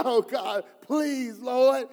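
a touch of tearful begging; mock crying plea. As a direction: beg the Lord as if near tears, hammed up. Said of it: "crying possibly".

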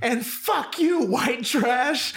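very high, slow parting insult; imitated angry driver. Driver stopping to insult Tani too, exaggerated mock fury. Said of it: "And she's like".

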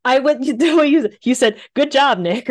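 laughing while talking; giggling. Real, not acted. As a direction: giggle through the words. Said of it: "And here I am giggling".

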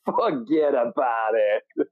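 drawn-out New York "Forget about it", laughing; New York wise guy, stretched slow; slow New York accent. Retold or imitated. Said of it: "I fucking love that New York accent".

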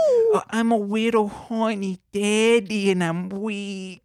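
put-on high, slow baby talk; babyish Betty Boop. Host plays Betty Boop reading note, baby voice. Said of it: "But she talks like a baby".

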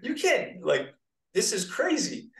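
half-laughing amazement; incredulous disbelief. Retold or imitated. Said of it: "you're just like".

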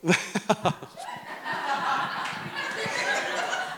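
airy high laugh breaking out; breathless high laugh. Real, not acted. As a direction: laugh helplessly at the idea. Laughs at own point.

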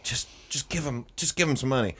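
soft, hushed plea; quiet urging. Retold or imitated. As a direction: quietly urge him to pay. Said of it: "And Jen was like".

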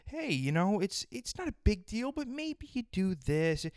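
mock a doctor tiptoeing around bad news; mock gentle doctor. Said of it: "speaking to me in a soft tone".

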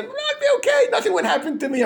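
laughing mock confidence. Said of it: "most of the people are tzaddikim".

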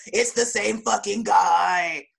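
slow, emphatic mock shout; hammed-up astonished outburst; mock outraged realization. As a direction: stretch every word in exaggerated disbelief. Acts out shocked recognition of actor.

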